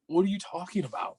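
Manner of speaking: acted-out bafflement; retold bewilderment. Retelling his confusion during breakup.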